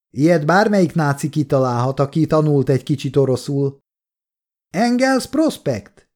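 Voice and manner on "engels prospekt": high, slow incredulous call; questioning call. A narrator's reading. Second soldier queries Kolja's address from outside, calling out. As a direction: draw the name out as you call it. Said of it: "kiáltotta másik hang".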